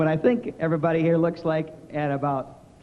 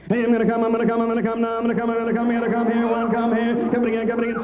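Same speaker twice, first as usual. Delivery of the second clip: auctioneer; 3 semitones higher than usual. Pretend. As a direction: rattle it off like an auctioneer in bed. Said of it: "my impersonation of an auctioneer".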